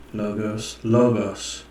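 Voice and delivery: ordinary talk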